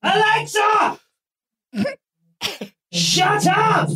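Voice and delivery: high, exasperated repeated shouts; shouting at Alexa. Real, not acted. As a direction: bark orders at a device that won't listen. Keeps shouting at smart speaker.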